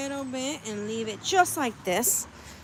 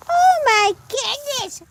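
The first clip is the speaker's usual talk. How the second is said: much higher, loud and slow; shocked gasp; 13 semitones higher, 6 dB louder, slower than usual. Real, not acted. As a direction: stretch it out in disbelief. Shocked at chickens.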